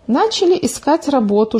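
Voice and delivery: plain reading voice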